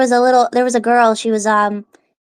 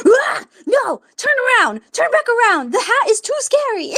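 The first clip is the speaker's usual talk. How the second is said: mock alarmed shout; 9 semitones higher than usual. Gaston shouting at her hat.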